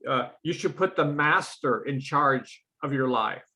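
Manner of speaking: ordinary delivery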